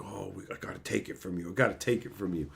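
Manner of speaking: low, soft, fast mock mumble of greedy workers; grabby slaves. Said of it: "everyone around him is like".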